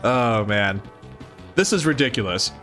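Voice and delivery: usual speaking voice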